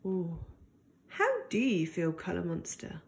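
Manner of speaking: soft bedtime ending; tender wondering. Acted. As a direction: lean in and wonder gently aloud. Tender question closing the story.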